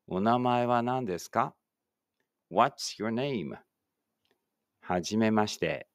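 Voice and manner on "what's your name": slow, carefully spaced English for the class to repeat; slow model reading. Shown as a demonstration. Teacher models English for pupils reading aloud together, slow and clear. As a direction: say each word slowly and clearly, like a teacher modeling. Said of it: "一緒に声出してください".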